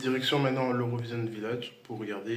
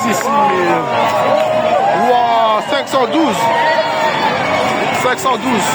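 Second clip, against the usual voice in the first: loud, high, breathless amazement; excited, relieved; 12 dB louder than usual. Real, not acted. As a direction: shout in disbelief at the result. Reacting to results, still shouting.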